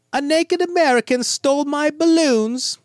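high-pitched, wobbly British schoolboy; British accent. Acted. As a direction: attempt a little British boy's voice, high and plaintive. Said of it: "I do not do a British accent very well".

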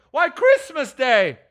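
put-on high, drawn-out boyish call; boy's voice. Boy telling Scrooge the day, high drawn-out boyish voice. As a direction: call it up as an astonished lad. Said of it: "replied the boy".

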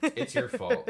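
squeaky high blaming voice; high mock accusation. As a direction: squeal the blame in jest.

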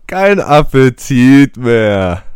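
slow, loud, exaggerated gloating; drawn-out mock gloat. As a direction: stretch it out like a cartoon villain. Gloating after spoiling appetite.

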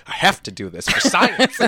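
high, loud, hammed-up justification; mock earnest priest. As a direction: declare it as a joke through laughter. Joking quote of the priest.

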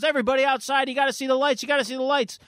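high-pitched, urgent summons; rally everyone in an excited high voice; excited calling. Acted. Storyteller as Donnie summoning party guests outside, excited calling.

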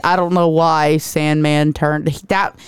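ordinary talk, nothing marked

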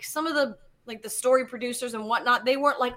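ordinary talk

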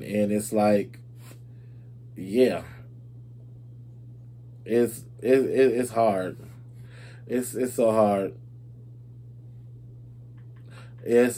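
low, soft, heavy with loss; real grief, halting and subdued; emotional. Real, not acted. Host telling listeners losing someone is hard, emotional. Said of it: "I'm gonna get so emotional".

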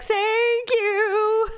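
high, drawn-out mock thank-you; mock grateful. Imagining his reply to being hurt, high and gushing. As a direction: squeal a put-on thank you.

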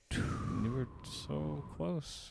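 slow, soft whisper with no voice. Real, not acted. Teasing friends who almost guessed, in a slow whisper. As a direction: draw it out in a hushed whisper.

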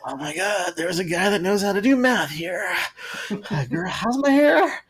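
put-on high, breathless party-girl voice; mock starstruck girl. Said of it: "They're like".

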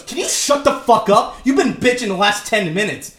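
retold upset outburst. Mom in line turning on the complainer, retold angry outburst. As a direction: snap it as a fed-up stranger. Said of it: "gets so fucking upset".

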